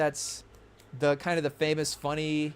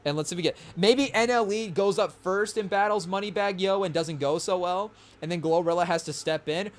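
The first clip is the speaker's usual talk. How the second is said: ordinary delivery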